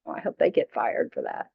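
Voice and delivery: low, smug mock-gloating mutter; petty spiteful wish. To friends, mock gloating over a disliked person's bad luck, low and smug. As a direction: play the small-minded grudge-holder gloating.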